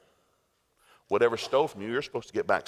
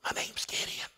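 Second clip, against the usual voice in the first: small, soft wimp voice; meek Gideon; 4 semitones higher, 7 dB quieter than usual. Retold or imitated. Gideon admits weakness to camera crew, meek voice. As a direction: confess timidly, starting in a whisper. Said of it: "Gideon out of his own admission".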